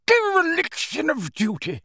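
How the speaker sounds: high, outraged portrait voice; a stuffy old wizard in a huff; indignant portrait. A narrator's reading. Portrait protesting to Phineas, indignant and high.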